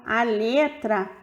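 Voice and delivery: ordinary talk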